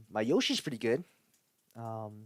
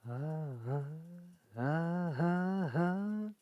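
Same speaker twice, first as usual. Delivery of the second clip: rising vocal warm-up; 4 semitones higher, much slower than usual. Pretend. Warming up Mario voice.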